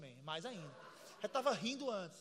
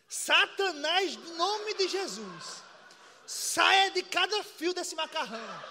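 mock exorcism prayer; 7 semitones higher, 6 dB louder than usual. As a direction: preach it like a fiery deliverance, over the top. Mock exorcism prayer over pasta. (oração de libertação exagerada, alta e lenta)

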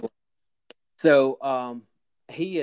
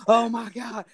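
a higher, mock scream that fades on the second "oh my God"; retold screaming; 9 semitones higher, 5 dB louder than usual. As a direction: imitate him still yelling while driving home. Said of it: "he's still screaming".

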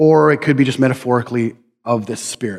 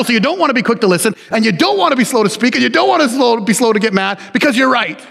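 fast, higher mock-rewrite of the verse; sarcastic twist; 7 semitones higher than usual. Shown as a demonstration. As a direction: twist the verse with a joking smirk. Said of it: "especially when you're right all the time".

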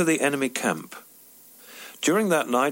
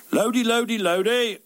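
jovial shout; 11 semitones higher than usual. Retold or imitated. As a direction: hail a mate across the street, cheerfully. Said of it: "he shouted jovially".

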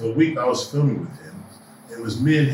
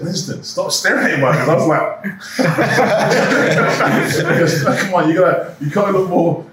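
director's order, laughing; 7 semitones higher, 6 dB louder, slower than usual. Retold or imitated. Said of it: "Christopher Nolan said".

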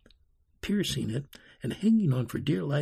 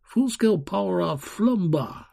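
put-on boy calling out, higher than the narration; a hint of an excited boy; lightly voiced boy; 6 semitones higher, 3 dB louder than usual. A narrator's reading. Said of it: "said a boy wearing a suit".

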